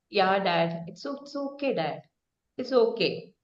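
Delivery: soft, low, resigned okay to dad; sad. Shown as a demonstration. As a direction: swallow the disappointment. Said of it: "You say it in a sad tone".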